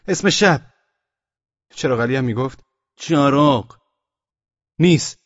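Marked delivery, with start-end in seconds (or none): 0.1-0.7 high, loud challenge; sentry's shout. A narrator's reading. 3.0-3.8 loud, higher reply; shouted answer. A narrator's reading. 4.8-5.2 high, loud rebuff; sentry's shout. A narrator's reading.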